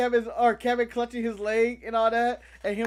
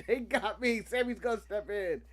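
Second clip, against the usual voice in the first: laughing, much softer aside; 4 semitones lower, 8 dB quieter than usual. Retold or imitated. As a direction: drop to a quiet, amused mutter. Same words, then muttered.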